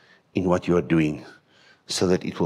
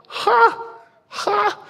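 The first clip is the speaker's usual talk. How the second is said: sharp mock laugh. Mocking his own past judging.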